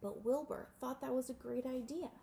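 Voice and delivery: ordinary delivery, nothing marked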